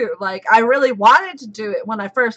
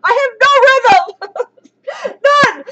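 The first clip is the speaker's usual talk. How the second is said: high, loud squealed confession; loud, high-pitched outburst; exclaiming loudly; 12 semitones higher, 7 dB louder than usual. Real, not acted. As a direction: belt it out high and laughing after. Failed drum roll, mock protest.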